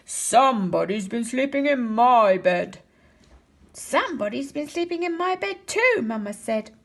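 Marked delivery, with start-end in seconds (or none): 0.1-2.8 louder, big growling bear voice; Papa Bear growling about his bed in a great big voice. A narrator's reading. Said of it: "he growled in his great big voice". 3.7-6.0 Mama Bear, higher; Mama Bear finding her bed slept in, higher voice; medium-sized Mama Bear voice. A narrator's reading. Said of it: "in her medium-sized voice".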